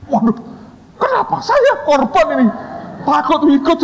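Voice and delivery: high-pitched dismayed whine; mock scared. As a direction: act out a dreading student in comic panic.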